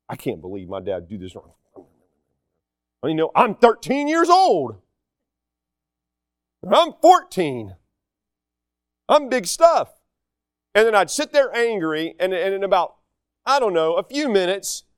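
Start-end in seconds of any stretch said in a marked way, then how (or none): 0.1-1.5 low, quiet sulking under the breath; imitating a mad kid grumbling low to himself; angry teen grumble. Teenager alone in his room after punishment, low angry grumble. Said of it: "just angry". 3.4-9.9 put-on self-important young teen, emphatic and drawn out; mocking his own puffed-up teenage self; mock teen indignation. Teenager mad at his dad, puffed-up mock indignation. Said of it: "sitting in my room just mad".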